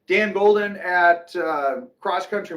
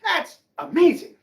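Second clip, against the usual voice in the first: hammed-up amazement at a bargain; mock amazed buyer; 7 semitones higher than usual. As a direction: gush like a dazzled shopper. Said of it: "you look at that and go".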